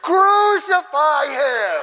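high, loud, stretched-out cry; imitated crowd shout. Kid in the crowd condemns the servant, imitated bloodthirsty shout. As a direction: mimic an over-zealous heckler yelling slowly. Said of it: "one time I heard".

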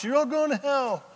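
high-pitched mock street-preacher cry; hammed-up condemnation, pitched well up; soapbox preacher. Soapbox preacher to passers-by, mock high-pitched damning cry. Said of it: "No sandwich board or soapbox".

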